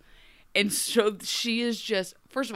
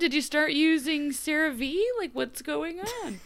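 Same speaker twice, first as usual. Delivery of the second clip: higher teasing questions; mock impressed Dorothy; 8 semitones higher than usual. Dorothy teasing Zelena about her skin, higher mock curiosity. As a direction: quiz her like a nosy friend. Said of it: "just a moment of".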